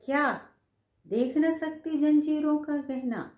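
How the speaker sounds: high, loud declaimed verse; poem recitation. Acted. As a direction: ring out the line with passion. (ऊँचे, ज़ोरदार स्वर में कविता पाठ)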